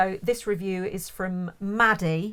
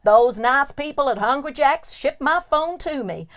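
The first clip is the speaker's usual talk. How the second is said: hammed-up American turning Southern; slightly dodgy US accent, sliding into the South; shaky American accent; 3 dB louder than usual. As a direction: enthusiastic American tourist with a wobbly accent. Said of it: "Sorry for my slightly shit accent".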